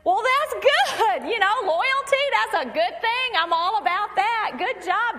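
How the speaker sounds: high, loud, overdone approval; humoring him. Acted. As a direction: cheer him on with mock enthusiasm. Acts out her humoring the boyfriend.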